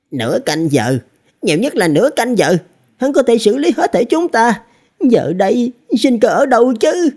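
panicked inner thought, climbing very high; hoảng sợ. A narrator's reading. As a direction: voice rises steadily into high-pitched dread. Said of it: "Thiên Dương đồng tử hoảng sợ". (giọng hoảng sợ, cao dần lên)

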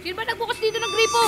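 high, hurried burst; alarmed question. Real, not acted. Player startled by a running faucet, panicky question. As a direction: blurt it out quickly, spooked. Jumpy about the running tap.

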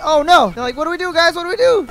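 high, loud mock panic; hammed-up crying crew; mock crying pirates. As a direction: whine it like a scared sailor. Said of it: "That was crying".

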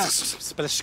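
urgent whispered shush; hushing whisper. Acted. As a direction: hush them fast. يسكتهم عن كلام الروح. (همس إسكات)

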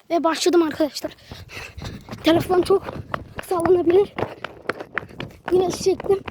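talking while running, breathless. Real, not acted. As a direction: speak in bursts mid-sprint. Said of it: "Şu an koşuyorum arkadaşlar".

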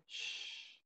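"Sh" as a soft, long hush; sh sound. Shown as a demonstration. Teacher repeats the symbol's sound, soft sustained "Sh". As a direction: sustain a voiceless "Sh". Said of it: "es el sonido sh".

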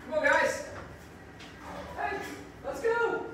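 high-pitched coaxing call; calling animals. Real, not acted. Zookeeper to penguins, come on out, coaxing.

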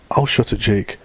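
plain reading voice

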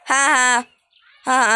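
ordinary talk, nothing marked

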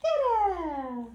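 playful drawn-out cheer; exclaimed refrain. Acted. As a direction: sing it out for baby. Parent repeats book's refrain to child.

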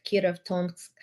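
ordinary talk, nothing marked

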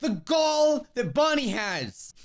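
slow, stretched disbelief; drawn-out indignation. Pretend. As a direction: drag it out, appalled. Outraged at Bonnie.

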